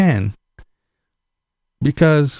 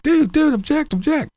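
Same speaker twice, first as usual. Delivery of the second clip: high-pitched exasperated cry of objection; yelling at the TV; 14 semitones higher than usual. Retold or imitated. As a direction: shout at the TV like the lawyer can hear you. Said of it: "I was screaming at the T V".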